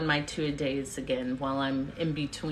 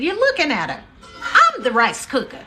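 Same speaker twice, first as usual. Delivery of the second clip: mom's comeback; 11 semitones higher, 10 dB louder than usual. Retold or imitated. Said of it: "She said".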